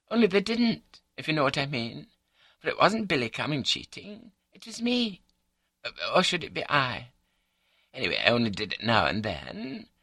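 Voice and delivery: high, chatty wife's voice; artless confessing wife. A narrator's reading. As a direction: confess it breezily, pitched well up.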